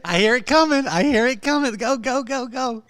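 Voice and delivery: high, loud eager cheer; urging her on to the punchline; excited anticipation. Real, not acted. Host cheering guest to the punchline, excited.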